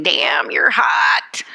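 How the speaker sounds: put-on slow slurred drunk pickup line. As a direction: drawl it like a tipsy barfly. Drunk bar patron quote.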